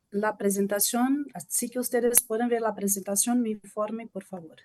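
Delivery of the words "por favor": ordinary talk, nothing marked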